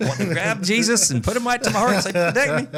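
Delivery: high-pitched comic panic; hammed-up retelling of being spooked; mock fright. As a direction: plead in a high, scared voice. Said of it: "I felt frightened".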